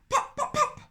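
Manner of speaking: very high popping; high popping string sounds. Acted. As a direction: squeak each pop out high.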